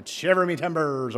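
hammed-up pirate with rolling R's; playful stock pirate greeting; pirate accent. Shown as a demonstration. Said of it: "you emphasize the pirate accent".

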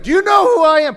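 high, full-volume outraged shout; yelling and screaming. Retold or imitated. Entitled customer yelling at the store manager, outraged. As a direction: shout it like a self-important customer. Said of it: "he started yelling and screaming".

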